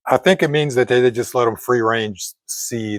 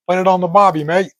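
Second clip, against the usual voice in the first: bad Australian accent, pitched higher; hammed-up Aussie barbie line; a bad Australian accent put on for Australian viewers; terrible Australian accent; 6 semitones higher, faster than usual. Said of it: "Yeah, terrible accent".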